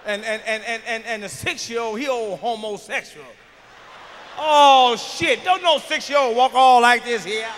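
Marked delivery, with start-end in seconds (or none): 4.4-7.5 loud, slow shouting rant; shouted rant. Acted. Comic yelling about a six-year-old, loud and slow. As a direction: yell it at the crowd, dragging it out.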